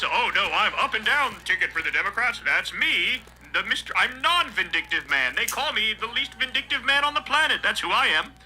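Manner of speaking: lightly voiced smug politician; a hint of a self-righteous politician; mock denial. Host mocking the politician's denial in a smug put-on voice.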